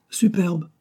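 "Superbe" pitched high and falling, with emphasis; emphatic agreement. A narrator's reading. Painter backing up praise of a friend's painting, emphatic.

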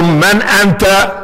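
Omar slowly explains high; high slow rephrasing. Retold or imitated. Omar rescues the caliph's question for the visitor. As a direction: make it clear to him, slowly. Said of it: "يلحق ابن عمه".

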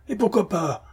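high-pitched, impatient retort; exasperated. A narrator's reading. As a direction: fire back the question in exasperation. (exaspéré, voix aiguë)

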